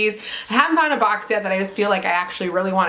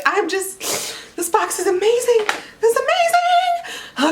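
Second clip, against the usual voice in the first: excited; 11 semitones higher than usual. Real, not acted. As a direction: swoon on amazing. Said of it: "I can't bring it back".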